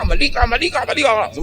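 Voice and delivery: high, rapid-fire mock scolding; angry accusation. Angry spouse blaming the other, acted high and agitated. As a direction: pitch it up and repeat the blame insistently. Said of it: "Galit na galit siya".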